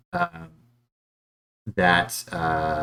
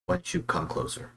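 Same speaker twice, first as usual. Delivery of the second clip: very soft, secretive near-whisper; hushed beckoning; 11 semitones higher, 9 dB quieter than usual. Acted. Guard luring a stranger out of sight, hushed. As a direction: keep it down as you draw them in.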